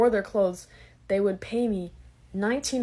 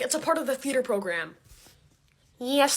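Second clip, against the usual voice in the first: ordinary talk, nothing marked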